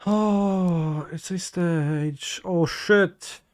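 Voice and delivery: loud, startled exclamation; surprised. Real, not acted. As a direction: caught off guard, blurting it out. Opponent reveals a surprise card.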